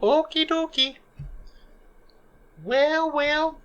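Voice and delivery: very high, tiny falsetto; squeaky character voice. Acted. Small character asking companions whether to go over, squeaky falsetto. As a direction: pipe up in a high little voice.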